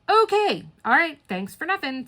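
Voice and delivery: high, exasperated "okay" easing down; mock-polite, fed-up retort; sarcastic brush-off. Traveler to an unhelpful airline employee, sarcastic.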